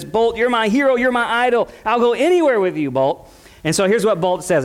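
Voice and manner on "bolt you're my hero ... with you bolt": higher-pitched adoring hamster, lightly voiced; hamster's admiring voice. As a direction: lightly play a starry-eyed sidekick hamster. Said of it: "that hamster says".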